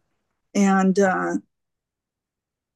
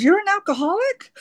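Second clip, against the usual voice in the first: much higher, rising mock disbelief; astonished question; 12 semitones higher, faster than usual. Husband reacts to wife's twelve-step program, astonished. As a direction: imitate a husband's incredulous question.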